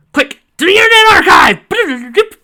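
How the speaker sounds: high, loud, slow cry; frantic shout. Acted. Donovan frantically crying out to rush to the archive. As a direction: cry it out like a rallying charge.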